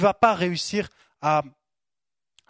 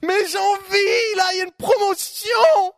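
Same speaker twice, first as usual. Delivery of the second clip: high, slow, wailing shout; whiny craving voice; 14 semitones higher, 7 dB louder than usual. Acted. The believer tempted by a sale, high wailing shout. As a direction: wail it like a shopper who can't resist. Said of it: "Oui, on est comme ça".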